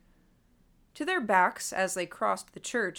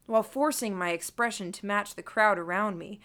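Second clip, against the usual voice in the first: ordinary delivery, nothing marked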